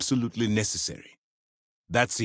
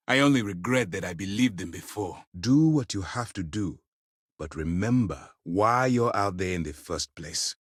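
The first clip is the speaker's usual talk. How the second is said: ordinary delivery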